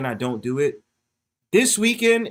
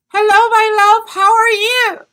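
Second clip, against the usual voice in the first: very high, loud mock-sweet greeting; high falsetto greeting a chatter; put-on sweet voice; 11 dB louder than usual. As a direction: coo a hello like a doting auntie.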